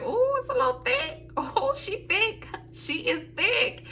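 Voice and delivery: very high, sassy squeal, slowing on thick; admiring the thick packet in a high giddy voice; giddy sass. Retold or imitated. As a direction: tease the envelope like it's flirting with you.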